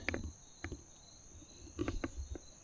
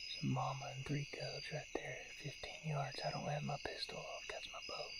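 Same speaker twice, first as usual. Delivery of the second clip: usual speaking voice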